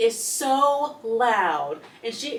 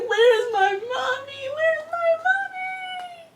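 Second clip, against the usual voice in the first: much higher, slow mock baby wail; baby crying; 3 dB louder, slower than usual. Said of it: "he's like".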